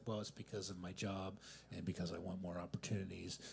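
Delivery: softly whispered stock answer; whispered excuse. Retold or imitated. Comic giving people his stock answer, whispered. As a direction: whisper it like a boring official reason.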